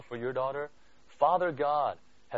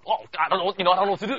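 mock frazzled; 4 semitones higher, 7 dB louder, much faster than usual. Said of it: "you don't see God just frazzled".

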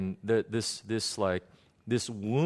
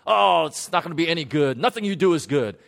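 loud, contemptuous put-down in a higher voice; harsh father; 6 semitones higher, 8 dB louder, faster than usual. Retold or imitated. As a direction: crush her with scorn. Grumpy father dismisses daughter's soup.

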